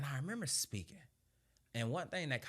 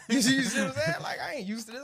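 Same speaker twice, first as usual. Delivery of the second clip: laughing; 14 semitones higher, 12 dB louder, faster than usual. Real, not acted. Laughing at own story.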